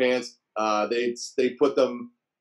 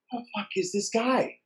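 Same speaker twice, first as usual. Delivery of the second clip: high, softer voice for a baffled look; mock puzzled inner voice, pitched up; imitated bewilderment; 9 semitones higher, 5 dB quieter than usual. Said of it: "a look in his face like".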